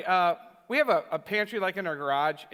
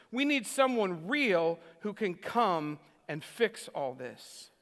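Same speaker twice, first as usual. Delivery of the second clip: slow and deliberate, dropping low on "all this"; slow, weighty quote; 3 semitones lower, 3 dB quieter, slower than usual. Retold or imitated. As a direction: quote it gravely, word by word, sinking at the end.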